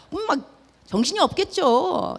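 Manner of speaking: ordinary talk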